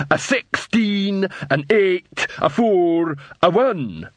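slow, high, dim troll counting bricks. A narrator's reading.

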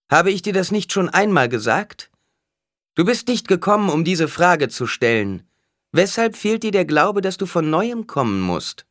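loud, high scolding; angry rebuke. A narrator's reading. Alia scolds a man who came before, angrily. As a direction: berate him in front of everyone. Oracle scolds the repeat petitioner.

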